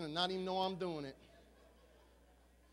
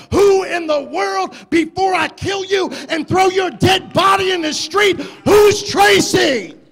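hammed-up furious wife, far louder, slow; furious wife shout; 8 semitones higher, 20 dB louder, slower than usual. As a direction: roar it, dragging out the last words.

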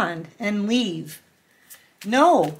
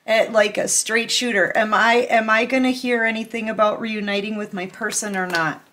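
ordinary delivery

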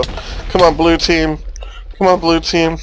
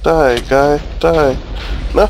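ordinary talk

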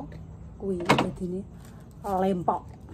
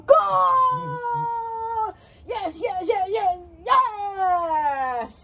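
touchdown call; 10 semitones higher, 4 dB louder, much slower than usual. Pretend. Mock goal call, cheering.

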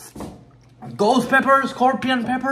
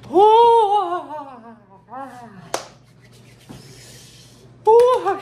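pained yelp; 4 semitones higher, 4 dB louder than usual. Real, not acted. Eating very hot pepper.

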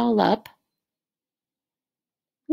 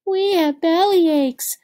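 piglet voices; 8 semitones higher, 4 dB louder than usual. Acted. Piglets moan after overeating.

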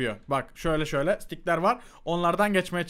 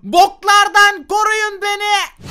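high, loud, drawn-out plea; mock cry for help; 12 semitones higher, 9 dB louder, much slower than usual. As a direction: holler for protection, stretching it out. Abartılı imdat çığlığı. (abartılı, tiz imdat bağırışı)

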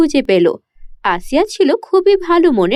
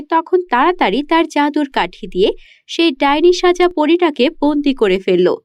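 plain reading voice

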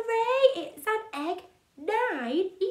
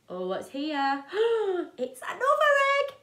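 ordinary delivery

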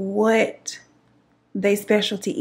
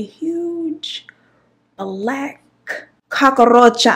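dramatic reveal; 4 semitones higher, 5 dB louder, much slower than usual. Retold or imitated. Reveal of the huge cockroach.

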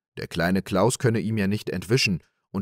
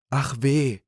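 higher, woeful sigh; sighing lament; 6 semitones higher, 3 dB louder than usual. Acted. Little Klaus knocked over in the sack, sighing. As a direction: let the sigh carry the words. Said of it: "seufzte der kleine Klaus".

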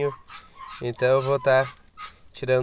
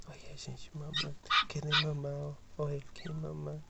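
hushed; 12 dB quieter than usual. Real, not acted. Whispering near the sleepy puppies.

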